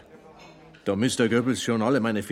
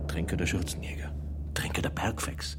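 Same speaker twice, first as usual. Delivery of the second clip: whispered; 2 semitones lower, 4 dB quieter than usual. Acted. Whispered self-labels.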